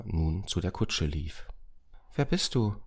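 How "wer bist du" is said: put-on high, curious child's question; curious boy. A narrator's reading. Moritz to the strange man by the coach, curious. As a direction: ask a stranger who he is, pitched up. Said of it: "fragte Moritz neugierig".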